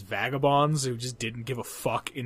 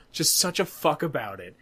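ordinary talk, nothing marked

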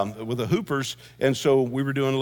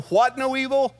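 mock puzzled question; 10 semitones higher than usual. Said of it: "David said in Psalm twenty-three".

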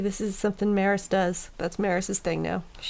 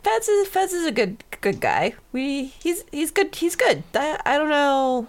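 uncertain defense; 7 semitones higher than usual. Acted. Warble stammers defending Fads.

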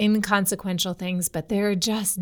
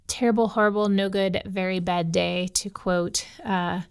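ordinary delivery

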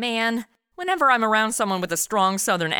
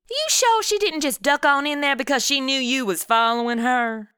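put-on southern accent; 5 semitones higher than usual. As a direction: talk like the desk clerk. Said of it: "I always start talking like 'em".

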